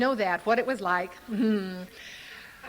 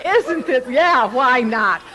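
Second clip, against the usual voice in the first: high, loud, whooping with delight; giddy, over the top excitement; carried away; 7 semitones higher, 9 dB louder than usual. Real, not acted. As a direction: let the joy spill over, laughing. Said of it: "I'm getting carried away".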